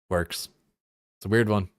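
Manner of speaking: ordinary talk, nothing marked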